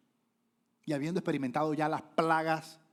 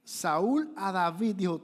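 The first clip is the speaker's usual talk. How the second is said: slow emphatic; 4 semitones higher, 5 dB louder, much slower than usual. Acted.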